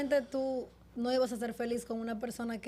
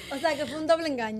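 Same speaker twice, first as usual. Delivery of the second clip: laughing; 3 semitones higher, 6 dB louder, faster than usual. Real, not acted. Interviewer laughs at the irony.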